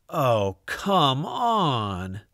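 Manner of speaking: usual speaking voice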